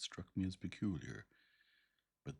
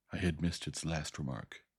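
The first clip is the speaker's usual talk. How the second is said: usual speaking voice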